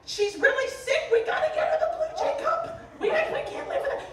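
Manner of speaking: very high, loud, laughing mock panic; frantic visitor. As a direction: squeal it like a desperate bird fan.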